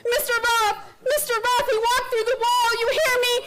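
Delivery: high, loud frantic telling; hysterical shout. Acted. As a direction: shout it at everyone in shock. Telling bystanders what happened.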